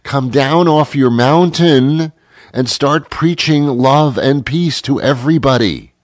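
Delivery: ordinary talk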